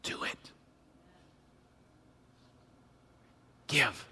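soft, breathy command in the ear; hushed voice of the Spirit; whisper. Acted. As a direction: whisper it, gentle but firm. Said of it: "the Holy Spirit whispers in your ear".

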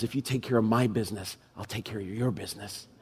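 low and soft, a gentle assurance; God's quiet promise to the pastor. Acted. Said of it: "Because God says".

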